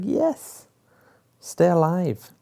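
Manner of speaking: high-pitched retold cheer. Viewer rooting for Grey Worm to survive, retold high-pitched cheer. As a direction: urge the fighter on in a high voice.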